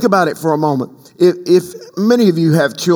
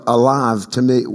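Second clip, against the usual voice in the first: usual speaking voice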